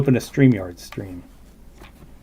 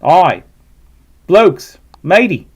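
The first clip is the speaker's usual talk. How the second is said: mock Australian accent; 3 semitones higher, 5 dB louder, slower than usual. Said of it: "terrible Australian accent".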